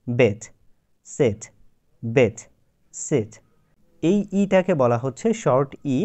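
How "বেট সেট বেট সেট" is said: বেট, সেট repeated with the in-between short e; slow careful বেট and সেট, vowel halfway from e to i; short e demo. Shown as a demonstration. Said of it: "এর মাঝামাঝি একটা উচ্চারণ হচ্ছে".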